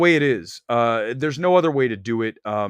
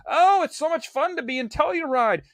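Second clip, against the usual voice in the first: high, gushing mock festival fan; sing-song mock of a festival fan; mocking festival-goer; 13 semitones higher than usual. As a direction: sneer at the gushing festival crowd.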